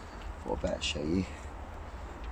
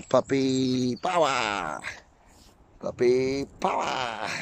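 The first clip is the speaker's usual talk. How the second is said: slow, drawn-out, higher-pitched hype chant; playful catchphrase; 6 semitones higher, 4 dB quieter, much slower than usual. Pretend. Vlogger hyping up the puppy, playful drawn-out call. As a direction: stretch it out high like a mock battle cry.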